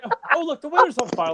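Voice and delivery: squeaky mock change of subject; imitating Joe. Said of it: "and Joe's like".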